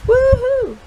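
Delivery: high, loud woohoo of joy; playful celebration cheer. Acted. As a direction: whoop like you're dancing. Happy dance cheer.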